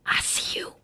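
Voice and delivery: spooky whisper; creepy ghost whisper; eerie whisper. Acted. Eerie ghost line on haunted train.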